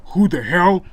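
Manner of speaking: startled outburst. A narrator's reading. Finds girl in shower.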